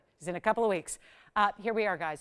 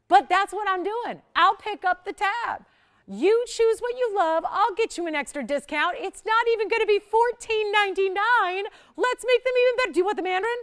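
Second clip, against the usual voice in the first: hyped sales pitch; 11 semitones higher, 8 dB louder than usual. Real, not acted. Host promising viewers an extra discount, loud and thrilled. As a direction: sell it like the best news ever.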